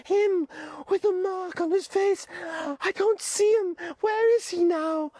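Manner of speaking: put-on high, pleading boy voice; high-pitched anxious boy Piggy; Piggy's voice. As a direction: voice the fretful schoolboy Piggy, pitched well up.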